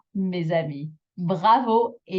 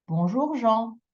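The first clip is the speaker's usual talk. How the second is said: bonjour lifted into a long JEAN; extra final intonation; 5 semitones higher than usual. Shown as a demonstration. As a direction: hold Jean at the end, higher. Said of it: "intonation on that last bit".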